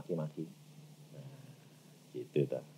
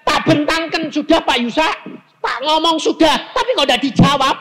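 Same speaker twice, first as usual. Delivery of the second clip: high, loud mock complaint; complaining believer; 12 dB louder than usual. Preacher mimicking a churchgoer complaining to God, loud and high. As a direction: play the impatient believer griping. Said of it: "Jangan saudara bilang".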